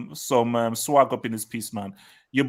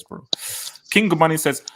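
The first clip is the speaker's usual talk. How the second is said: ordinary talk, nothing marked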